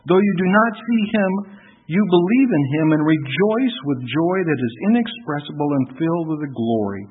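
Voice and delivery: loud, rising preached reading; scripture reading. Acted. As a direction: proclaim the passage from the pulpit. Reads First Peter aloud.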